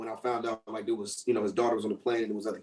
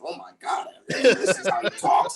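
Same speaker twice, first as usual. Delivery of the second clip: stunned onlookers; 7 semitones higher, 3 dB louder, slower than usual. Retold or imitated. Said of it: "people like".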